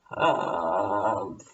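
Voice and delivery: very low, slow grumble; low mummy groan. Acted. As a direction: growl it like a mummy.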